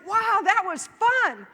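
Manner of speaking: slow, high, loud delighted wow; beaming after the ride; thrilled. Retold or imitated. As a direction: savor the fun, drawn out. Relieved after crossing.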